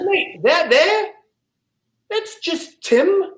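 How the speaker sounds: high, slow, hammed-up South African; bad South African accent. Captain introducing a shark by name, mock South African. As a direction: dismiss the danger in mock South African, drawn out. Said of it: "can't do a South African accent".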